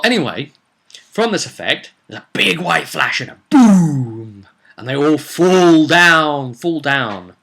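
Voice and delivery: normal talking voice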